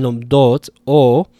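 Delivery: lomdot with a long, strong o, then o alone; long vowel. Shown as a demonstration. Teacher isolating long vowels, lomdot and o held long. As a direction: lean on and stretch the o of lomdot. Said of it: "these have long vowels in them".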